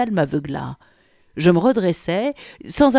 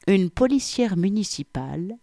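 usual speaking voice

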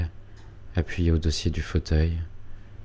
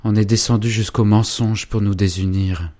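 put-on ardent young man, louder, pressing hard; urgent lover; 3 semitones higher, 6 dB louder than usual. Félicien urging Angélique to leave her dreams, loud and fervent. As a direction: urge her to come away with you.

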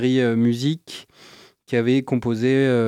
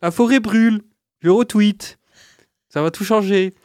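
mocking voice; 6 semitones higher, 5 dB louder than usual. Mocking careless people.

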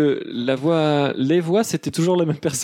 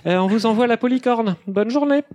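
operator voice; 7 semitones higher than usual. Acted. Operator voice in sketch.

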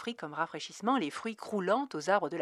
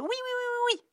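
put-on gleeful child shout, much higher; child yelling. A narrator's reading. Cerise running to the door, high gleeful yell. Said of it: "hurla la gamine".

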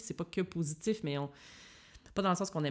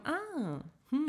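puzzled reaction; 10 semitones higher than usual. Retold or imitated. Listeners puzzled by her accent, hesitant and wondering. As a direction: imitate someone unsure what they just heard. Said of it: "Ils vont faire comme".

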